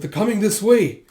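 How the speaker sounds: high, loud warning cry; alarmed warning. Retold or imitated. Warning of soldiers riding towards them, alarmed. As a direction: warn them urgently.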